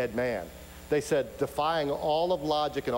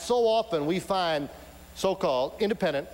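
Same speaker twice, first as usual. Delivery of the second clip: usual speaking voice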